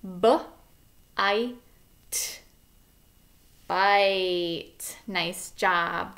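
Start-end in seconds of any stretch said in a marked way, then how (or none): 0.0-5.0 segmenting b-i-t then blending; sounding out. Shown as a demonstration. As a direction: sound out each letter slowly then say bite. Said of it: "the first one we're gonna do together is".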